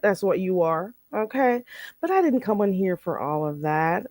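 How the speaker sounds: normal talking voice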